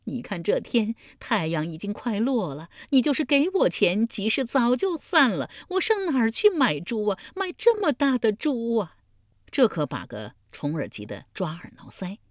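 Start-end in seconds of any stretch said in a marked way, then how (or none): 0.0-9.0 high, loud and tearful, rising as she goes; a grieving old woman who will not be consoled; crying while talking. Acted. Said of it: "老妪一边哭一边说". (边哭边说)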